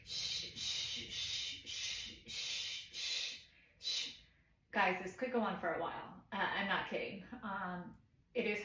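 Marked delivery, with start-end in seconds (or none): 0.0-4.1 long, steady, repeated "shh"; soothing shush. Shown as a demonstration. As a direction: keep hushing while patting the baby. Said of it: "patting them repeatedly and going".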